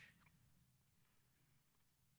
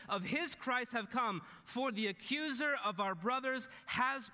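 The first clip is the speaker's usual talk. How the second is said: higher-pitched, ringing proclamation; loud heavenly voice; 7 semitones higher, 4 dB louder than usual. Acted. Preacher reads out the voice from heaven, proclaiming loudly. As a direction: proclaim scripture like a herald, slowing at the end. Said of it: "this loud voice says".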